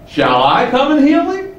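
"Shall I come and heal him?" with I pushed high, staying up as a question; stress on I. Shown as a demonstration. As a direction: ask it incredulously, punching the word I. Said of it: "the pronoun I is emphasized".

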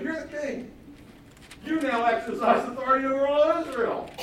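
high, loud insistence; wife reminding the king of his authority; emphatic wife. Acted. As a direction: pump him up with his own power. Wife rouses husband.

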